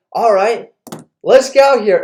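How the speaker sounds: loud, high call-out; acted-out father spoiling for a fight; retold challenge, thrown out forcefully; challenging dad.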